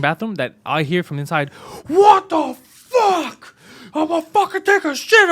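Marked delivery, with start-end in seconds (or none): 1.9-5.3 high, drawn-out outraged bellow, retold; imitated angry yelling. Man yelling from inside a bathroom stall, high mock-outraged shout. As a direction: bellow it like a furious man behind a door.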